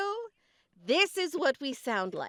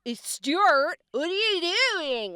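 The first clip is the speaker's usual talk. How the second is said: higher, louder mock Californian drawl; SNL Californian parody; 8 semitones higher, 4 dB louder, slower than usual. Host showing what people think Californians sound like, hammed-up Californian accent. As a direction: ham up the satirical Californian accent. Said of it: "do the S N L".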